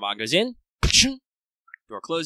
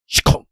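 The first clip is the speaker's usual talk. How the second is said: loud mouth slam noise; slam sound effect; 8 dB louder than usual. Acted. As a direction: bark a door slamming. GM makes slamming sound.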